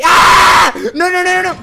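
high, loud screaming no no no; terrified scream. Real, not acted. Player jumpscared by the penguin, real terrified scream. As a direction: shriek in real panic.

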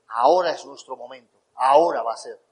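voz mucho más grave, con convicción; esposa solemne imitada. Retold or imitated. Cita solemne de su mujer. (solemnidad actuada)

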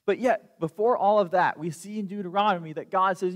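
usual speaking voice

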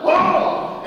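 loud high cry of impact; punch grunt. Acted. Kronos crying out as Zeus punches him. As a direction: yelp as the blow lands. Fight sound effects, punches landing.

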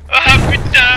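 cri de surprise furieux; lâche le juron à pleine voix; juron crié. Acted. Attaque ennemie, juron crié. (juron crié)

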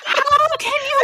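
very high, outraged mock wail; retold anger. Wife to husband who burst out laughing, outraged mock wail. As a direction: protest shrilly at being laughed at. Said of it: "that just made me angry".

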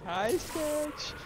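high recorded doll greeting; talking doll. Pretend. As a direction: pipe it up like a toy playback. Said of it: "Push, push the button".

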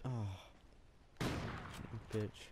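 breathy whispered insult; whispering. Real, not acted. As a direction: whisper it playfully. Hushed insult at end.

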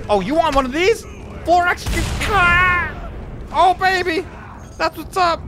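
high, shouting battle rage; furious battle cry. Acted. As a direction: roar it out in mock fury. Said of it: "I'm furious".

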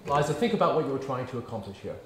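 usual speaking voice

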